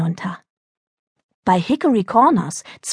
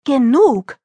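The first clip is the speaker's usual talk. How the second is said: raised, higher-pitched cut-off; exasperated shout; 4 semitones higher, 3 dB louder than usual. Acted. Mother silencing her daughter, understated angry shout. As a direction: put a stop to it, mildly shouted. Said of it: "schrie Clarice".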